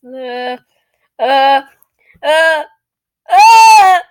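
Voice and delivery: escalating cries of alarm; mounting horror. Acted. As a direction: build from a small uh to a yell. Mounting horror at dead grandmother.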